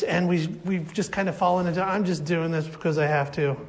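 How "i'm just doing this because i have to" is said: low, soft mock begrudging. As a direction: sigh it out like a chore. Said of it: "with this begrudging spirit".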